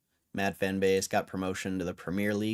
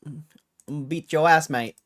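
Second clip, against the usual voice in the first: mock English accent; 4 semitones higher, 3 dB louder than usual. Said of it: "can't do my English accent anymore".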